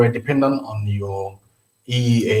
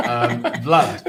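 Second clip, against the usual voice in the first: laughing. Real, not acted. Host answering while still laughing.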